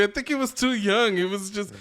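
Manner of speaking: usual speaking voice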